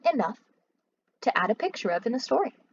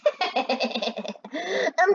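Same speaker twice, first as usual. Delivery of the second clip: short scornful laugh; mocking laugh; 5 semitones higher than usual. Louis laughs at Mac's warning, scornful. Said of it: "Louis laughed".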